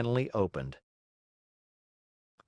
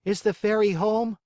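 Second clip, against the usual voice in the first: put-on higher, louder boyish puppet voice; the puppet calls up to the window; Pinocchio asking; 11 semitones higher, 5 dB louder than usual. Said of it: "asked the Marionette".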